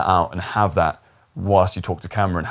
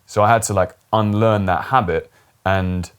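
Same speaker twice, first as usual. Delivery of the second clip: usual speaking voice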